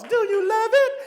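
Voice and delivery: giddy high-pitched shopper voice; high squeaky gushing. Pretend. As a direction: squeal it like you adore the shirt. Shirt question and answer bit.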